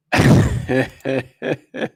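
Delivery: long amused chuckle; helpless run of chuckles; real chuckle. Real, not acted. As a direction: chuckle away at a comment just read. Amused by a viewer's comment.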